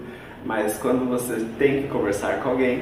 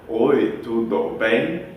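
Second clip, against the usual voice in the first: slow syllable-by-syllable gringo Portuguese, broken apart; choppy gringo Portuguese; much slower than usual. Retold or imitated. Teacher mocking a gringo, slow choppy Portuguese. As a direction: sound like a gringo building it piece by piece. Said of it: "Ele aprendeu sílaba por sílaba e não a frase".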